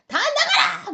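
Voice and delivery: high-pitched furious command; angry yell. Acted. Jesus clearing the temple, angry high yell. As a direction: yell to drive everyone out. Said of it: "막 소리 지르시고".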